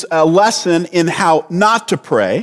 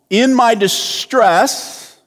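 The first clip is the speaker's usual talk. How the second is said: "distress" stretched out slowly, pitch raised; high, slow phrase weighted on "distress"; stress on distress; 5 semitones higher, much slower than usual. Shown as a demonstration. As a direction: dwell on the word "distress". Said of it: "Jonah's distress. It gets the emphasis".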